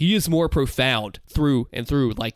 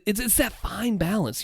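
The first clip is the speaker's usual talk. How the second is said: ordinary talk, nothing marked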